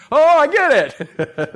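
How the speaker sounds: high, slow, wide-eyed realization; disciples getting it. Pretend. Disciples' light bulb moment.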